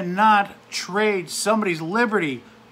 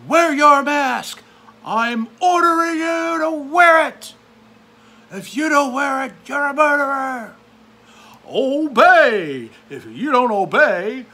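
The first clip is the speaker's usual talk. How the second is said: shouted, much higher bossy official voice; mock authority; 8 semitones higher, 8 dB louder than usual. Vlogger mocking officials ordering people, hammed-up shout. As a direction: bark orders like a petty tyrant.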